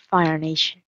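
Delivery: ordinary talk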